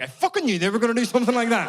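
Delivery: loud, high-pitched mock rage; furious outburst. Comedian acts out Irish people watching the play, furious shout. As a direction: explode as the furious Irish audience member. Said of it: "gradually more and more furious".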